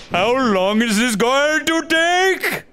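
high, slow whiny complaint; impatient put-on voice. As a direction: whine like a fussy inspector.

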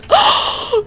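high, sharp gasp; big startled intake of breath; shocked gasp. Real, not acted. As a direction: gasp as if just caught. Caught in the game.

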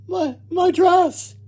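very high, halting cry of dismay; Missus Rogers exclaiming. A narrator's reading. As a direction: stammer it slowly in horror. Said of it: "exclaimed Missus Rogers".

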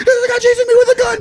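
high, fast, laughing panic; mock-panicked high rush; panicked friend.

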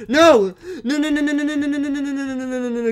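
high, loud string of frantic noes; panicked yelling. Real, not acted. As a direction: yell no over and over in real panic. Teammate about to lose fight.

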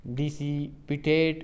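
dissipate stretched very slowly, syllable by syllable; drawn-out word. Shown as a demonstration. Teacher reads the option to students, very slowly. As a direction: let dissipate hang, very slow. Reading an option, then repeating it.

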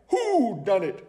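high, hooting demand; high-pitched detective outcry; owl's cry. Acted. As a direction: cry out like a baffled owl. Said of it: "Hubert cried".

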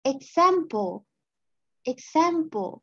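"example" with a clear ks sound at the start; careful model. Shown as a demonstration. Teacher fixes the first sound of "example": careful model. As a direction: say "example" as ig-zam-pel, each syllable clear. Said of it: "ที่มันบ่มีตัว E ทั้งหน้า".

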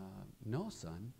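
soft, hesitant dad; a hint of a reluctant father; quiet father. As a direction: let the father answer low and soft. Father answers quietly in car.

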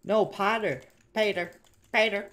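high, very loud repeated cries; alarmed shouting. Real, not acted. Crying out over Bader in game.

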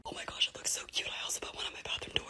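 real whisper to save a sick voice; soft whisper, no voice at all. Real, not acted. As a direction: whisper it, voice is shot. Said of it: "I'm just gonna whisper".

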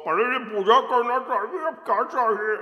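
high, loud voice through a mouthful of pan; mouth full of pan. Retold or imitated. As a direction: talk through chewed pan like the men in movies. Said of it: "And they eat like this".

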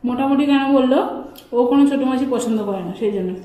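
speaking between bites; talking while chewing. Real, not acted. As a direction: mouth partly full of food. Seen 0: Both diners chewing mid-meal; speaker unclear, woman ate just before.

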